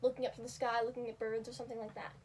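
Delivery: ordinary talk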